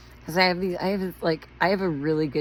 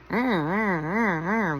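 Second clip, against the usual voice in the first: cartoon wolf whistle cry; 3 semitones higher, 4 dB louder, much slower than usual. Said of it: "with the cartoon characters".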